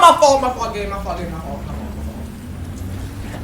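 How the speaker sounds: trailing off to a whisper; fading apology. Real, not acted. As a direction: let it die away.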